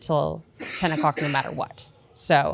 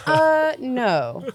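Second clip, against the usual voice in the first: high stoned refusal; 8 semitones higher than usual. Retold or imitated. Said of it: "I was like".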